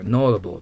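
"notable" with the T softened away; silent T. Shown as a demonstration. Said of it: "Tを発音しないので気をつけてください". (Tをはっきり言わない「notable」)

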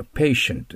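patient said slowly, PAY-shent, not pa-ti-ent; correct pronunciation. Shown as a demonstration. Teacher correcting the katakana reading of patient. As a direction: stretch out patient with a clear sh. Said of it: "パティエントって書いてますけど".

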